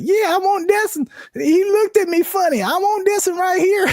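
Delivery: very high-pitched cartoon girl impression; Honey Boo Boo. Girl picking out a pig from a farmer, squeaky caricature. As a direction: squeal it out as a caricatured little girl, ending in a laugh. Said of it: "like, Honey Boo Boo".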